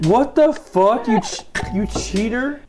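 slow, high disbelief; shocked outrage. Real, not acted. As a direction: drag out the shock. Catches her scraping them off.

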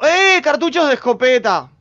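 loud, high burst of excitement; excited find. Real, not acted. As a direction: exclaim at a lucky loot find. Spots shotgun shells while looting.

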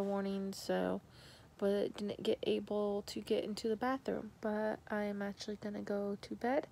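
quiet late-night chat from bed; hushed bedtime talk. Real, not acted. Telling viewers goodnight from bed beside her puppy, hushed. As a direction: keep it hushed so the puppy sleeps.

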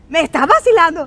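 loud, disbelieving call back; shouting across the room. Retold or imitated. Husband calling back from the corner, loud and incredulous. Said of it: "a los gritos".

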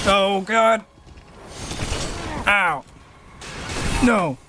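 high, startled cries of protest; panicked yelps. Real, not acted. Player being hit by monster, panicked yelps. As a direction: yelp as the blows land.

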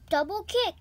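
excited move call. Pretend. Calls move.

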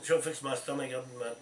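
ordinary talk, nothing marked

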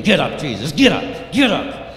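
urgent, insistent command; rising, forceful repeated order; soldier's harsh order. Acted. Soldier kicking fallen Jesus.

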